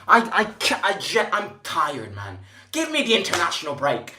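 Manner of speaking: ordinary delivery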